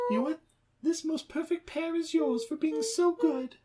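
high singsong enticement; coaxing pet talk. Acted. Owner tempting Buddy with a pear, high coaxing. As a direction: talk to him like a toddler, high and sweet.

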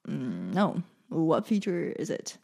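low, soft mock puzzlement; quietly acting out not knowing; acted hesitant reply. Voices own puzzled reply to mom.

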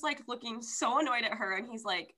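ordinary delivery

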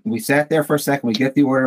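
ordinary delivery

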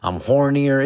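ordinary delivery

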